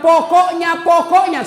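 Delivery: loud, high insistent mock nagging; stubborn wife. Nagging wife cutting off her husband, loud and high. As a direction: hammer the word like a wife ending the argument. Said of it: "kalau nak bilang".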